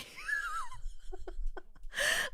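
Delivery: squealing high laugh that breaks. Real, not acted. Laughing while recalling the tense family scene. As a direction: burst into a squeaky embarrassed laugh. Laughing at own awkward memory.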